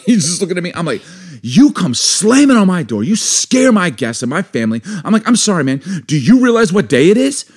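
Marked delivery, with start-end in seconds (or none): 1.4-7.4 raised-pitch, emphatic scolding, half in jest; indignant tirade. Retold or imitated. Wife berates neighbour on Super Bowl Sunday, indignant. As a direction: hammer each key word in mock outrage.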